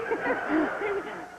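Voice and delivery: short high laugh; a quick bright giggle. Real, not acted. Telling the panel, short high giggle.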